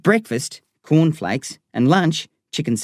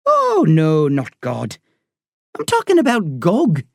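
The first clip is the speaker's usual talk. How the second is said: put-on lightly Irish-accented doctor, higher and slow; Irish woman doctor talking to a boy patient; 5 semitones higher than usual. A narrator's reading. Doctor voiced in story.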